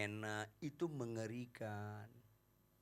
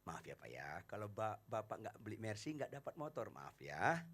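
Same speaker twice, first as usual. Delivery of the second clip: soft, apologetic and softer; calm the customer down softly; gentle salesman; 6 dB quieter than usual. Acted. Salesman softly apologizing to the angry customer. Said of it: "Maka dia lembut".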